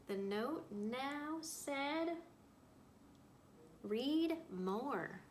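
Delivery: reading a story aloud to a class; storyteller voice for young listeners; picture book read-aloud. A narrator's reading. Teacher reads picture book aloud.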